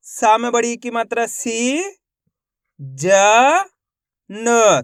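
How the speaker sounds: name the sign, then hold "सी"; "जा" and "न" long, with pauses between; syllable by syllable. Shown as a demonstration. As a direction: sound out each letter separately. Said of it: "एक ही अक्षर का उच्चारण".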